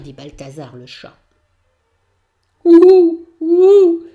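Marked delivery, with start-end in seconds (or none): 2.6-4.0 loud high hooting; loud owl call. Acted. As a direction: hoot like an owl in the night. Owl hoots.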